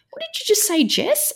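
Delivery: a startled mum demanding an answer; shocked high question. Retold or imitated. Mum in the car asking her daughter, in shocked high disbelief. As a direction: pitch way up in alarmed disbelief.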